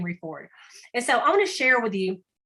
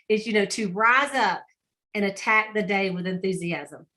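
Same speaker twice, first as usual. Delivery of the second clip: ordinary delivery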